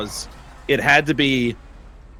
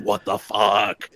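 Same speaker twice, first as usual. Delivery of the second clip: inner disbelief; 7 semitones lower, slower than usual. Retold or imitated. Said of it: "in my head going".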